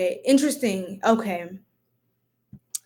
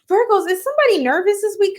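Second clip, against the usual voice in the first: high, louder teasing call; 9 semitones higher, 3 dB louder than usual. Real, not acted. Reader calling out to nervous Virgos. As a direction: tease the viewers up high.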